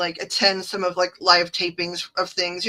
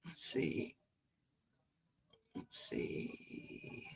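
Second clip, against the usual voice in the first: soft unvoiced murmur while reading; whisper to herself; 21 dB quieter, much slower than usual. Real, not acted. Streamer whispering to herself while scanning the chat.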